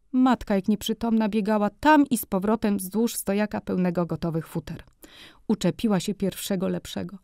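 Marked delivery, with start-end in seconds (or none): none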